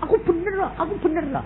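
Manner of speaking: high, stubborn mock insisting; mock self-righteous insistence. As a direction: squeal it out like a proud know-it-all. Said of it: "kalau kamu udah bilang".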